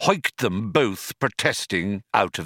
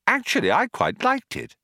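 much higher, chirpy politeness; bright; 13 semitones higher than usual. A narrator's reading. Arthur praising the Vogon's poem, bright. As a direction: say it brightly, pitched well up. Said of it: "Arthur said brightly".

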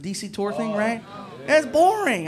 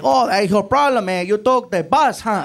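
Puerto Rican clerk; 3 semitones higher, 6 dB louder, slower than usual. Retold or imitated. Juan teasing guest about bus.

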